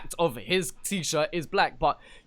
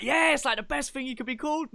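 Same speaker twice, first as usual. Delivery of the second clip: mock enthusiastic reassurance; 10 semitones higher, 6 dB quieter than usual. Voices commenters reassuring him.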